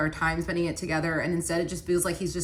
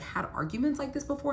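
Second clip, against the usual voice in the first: plain reading voice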